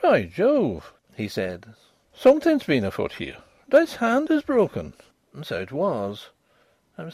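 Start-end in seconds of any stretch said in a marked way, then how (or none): 0.0-0.9 loud, higher Irish exclamation; startled Irish doctor; Irish accent. A narrator's reading. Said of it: "his own unmistakable accents". 2.2-4.9 loud, high-pitched Irish discovery; surprised Irish doctor; Irish accent. A narrator's reading. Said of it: "his own unmistakable accents".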